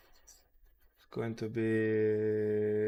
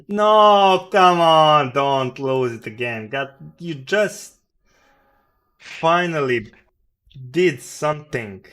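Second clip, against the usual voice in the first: loud, higher pleading yell; frustrated shout; 4 semitones higher, 12 dB louder than usual. Real, not acted. Trader yells at the price not to lose its gains, loud frustrated pleading. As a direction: shout at the screen, begging it not to drop.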